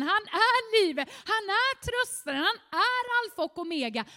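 loud, exalted preaching; shouted proclamation. Real, not acted. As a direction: cry out each declaration with rising fervour. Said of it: "Förlåt mig att jag ropar". (ropande, exalterad förkunnelse)

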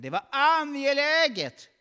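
high, loud, slow stressed line; emphatic. Acted. Preacher stresses Daniel's urgency, loud. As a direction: lean hard on it.

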